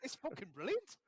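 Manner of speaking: high Geordie accent; hammed-up, sweary Geordie. Shown as a demonstration. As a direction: gush it in a high-pitched Geordie voice. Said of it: "It's my impression of".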